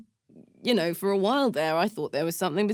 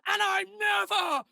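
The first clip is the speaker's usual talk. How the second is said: high, ringing cry of defiance; defiant shout; 11 semitones higher, much slower than usual. Acted. Freed from the furnace, swearing never to return, shouting. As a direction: throw it out, never again.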